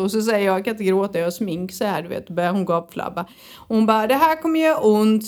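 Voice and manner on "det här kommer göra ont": louder, higher warning of the coming pain; warning therapist. Retold or imitated. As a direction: announce the pain like a cheerful torturer. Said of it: "sa hon".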